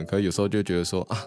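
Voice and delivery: ordinary delivery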